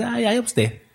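lightly voiced scoffing brother; mocking dismissal. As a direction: brush him off in a mock whine.

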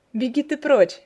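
light-hearted shooing away; cheerful. A narrator's reading. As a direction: toss it out loudly and merrily. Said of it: "весело бросила Тенна".

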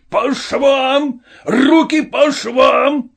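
high, drawn-out parade-ground roar; roaring command. A narrator's reading. Colonel ordering the Cossack to attention, roaring. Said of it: "взревел полковник".

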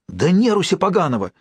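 ordinary delivery

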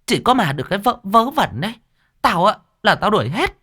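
put-on high, sharp scolding old landlady; scolding shout. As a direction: snap at them to stop the nonsense. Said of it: "cô Thuận quát lên".

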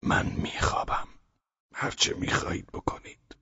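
barely voiced words, forced out through pain; strained whisper. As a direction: whisper as if it hurts to speak.

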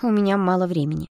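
mildly defiant reply; frowning defiance. A narrator's reading. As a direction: push back with a frown. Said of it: "нахмурилась Стеша".

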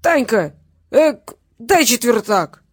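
put-on loud, slow drunken shout with a hiccup; drunk demand. A narrator's reading. Drunk father demands money from his daughter, bawling. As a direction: holler for cash like a drunk.

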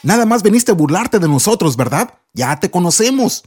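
lightly voiced scornful older brothers; mocking brothers. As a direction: sneer at the little brother. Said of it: "le dijeron".

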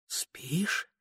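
hushed, rising whisper; whispered question. A narrator's reading. The flame asking the sleeper, whispered. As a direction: whisper it to someone dozing. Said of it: "пролепетало пламя".

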